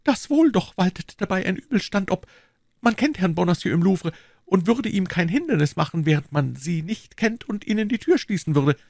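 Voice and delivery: put-on young woman's voice, pitched very high; high-voiced lady confiding in a gallant; Madame Bonacieux. A narrator's reading.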